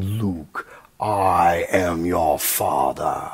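low, very slow dragged Vader line; Vader impression. Vader to his son, very slow and low. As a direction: stretch every word deep like the dark lord. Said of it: "I always remembered it being".

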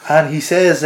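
normal talking voice

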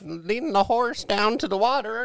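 put-on high, slow old lady voice.

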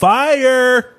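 excited. Real, not acted. Praising the restaurant.

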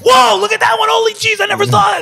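high, loud, amazed cry over a photo; amazed excitement. Retold or imitated. Photographer back at camp, thrilled by his own shot, amazed high shout. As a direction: gasp at the shot you never saw.

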